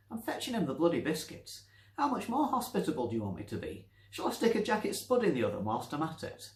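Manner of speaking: put-on high, loud, quick exasperated old woman; exasperated old Nancy. Nancy snapping back at the voice, exasperated. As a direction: huff at the voice in mock outrage.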